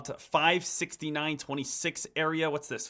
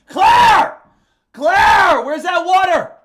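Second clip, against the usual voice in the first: loud shout, much higher; calling out; 13 semitones higher, 15 dB louder, much slower than usual. Real, not acted. Calling Claire from another room for water, loud shout. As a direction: yell across the house for her.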